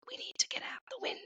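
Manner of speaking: urgent unvoiced whisper. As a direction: hide and whisper it. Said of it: "you're trying to hide from somebody".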